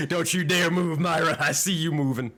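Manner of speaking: mock scolding shout; loud playful telling-off; mock angry. GM scolds player for moving token.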